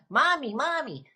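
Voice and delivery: put-on high-pitched child calling Mommy; imitating her son. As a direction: call out like a little kid wanting mom. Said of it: "So, and my son".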